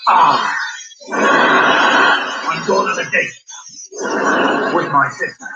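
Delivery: slow West Country pirate drawl; hammed-up West Country pirate voice. Shown as a demonstration. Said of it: "it's the pirate voice".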